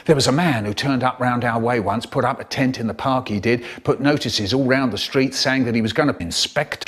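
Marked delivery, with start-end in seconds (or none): none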